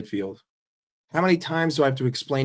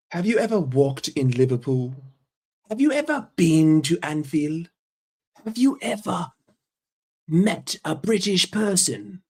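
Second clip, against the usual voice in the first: higher-pitched questions in a put-on British accent; mock British accent; 3 semitones higher than usual. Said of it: "we have a great accent".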